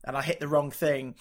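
ordinary talk, nothing marked

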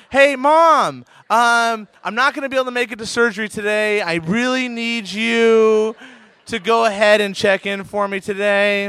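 lightly acted, overly casual plea to mom; surgeon phoning mom. Surgeon phoning mom, hypothetical favour.

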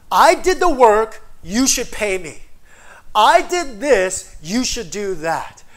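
a laborer demanding wages from his boss; demanding worker. Retold or imitated. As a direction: demand payment from God. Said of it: "saw God as an unfair boss".

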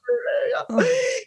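high, hammed-up wail; mock crying. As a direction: imitate bursting into sobs for laughs. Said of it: "And I start crying".

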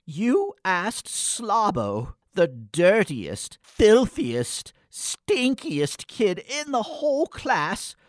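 indignant shout at the host; outraged. Acted. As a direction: climb in disbelief with each insult. Outraged at Slabo cooking.

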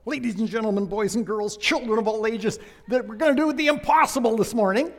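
booming circus ringmaster announcement. Pretend. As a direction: announce it like a big top showman.

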